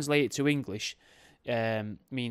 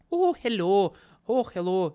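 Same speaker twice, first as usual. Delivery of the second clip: loud, high, badly faked Scottish greeting; hammed-up attempt at a Scottish accent; 8 semitones higher, 9 dB louder than usual. Host trying out Scottish greetings, terrible Scottish accent. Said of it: "Terrible at accents".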